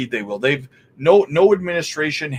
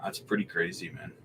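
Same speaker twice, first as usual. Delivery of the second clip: said again, much softer, to himself; softer, almost to himself; quiet repeat; 5 semitones lower, 11 dB quieter than usual. Real, not acted. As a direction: mutter it under your breath in disbelief. Repeats reaction to token count.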